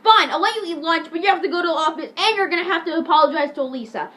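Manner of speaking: mock telling-off; retold cross principal. Principal lays down terms to Eric, mock cross orders.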